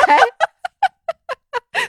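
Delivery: loud, high burst of laughter; rising peal of laughter. Real, not acted. Laughs after friend's verdict.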